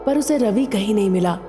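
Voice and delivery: ordinary delivery, nothing marked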